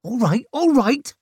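loud, high irritated snap; snapping. A narrator's reading. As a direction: cut them off impatiently. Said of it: "snapped Ricker".

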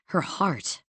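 ordinary delivery

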